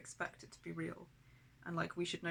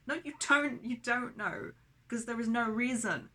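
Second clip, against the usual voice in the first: loud, higher angry snap; sharp retort; 4 semitones higher, 5 dB louder than usual. Real, not acted. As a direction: cut her off angrily. Snapping back at mum.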